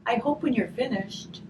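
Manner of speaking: gentle storybook reading, slow and warm; reading to children. A narrator's reading. Teacher reading a grief picture book to children, gentle storytelling.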